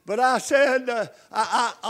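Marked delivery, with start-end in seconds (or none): none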